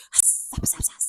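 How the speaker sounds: radiator hiss. Retold or imitated. Imitating the hissing radiator.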